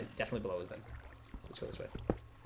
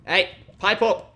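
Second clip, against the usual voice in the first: loud, raised holler; calling out; 5 semitones higher, 12 dB louder than usual. Pretend. Gamer telling a hidden creature to pipe up, calling out. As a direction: shout to someone through the walls.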